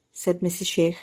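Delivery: ordinary delivery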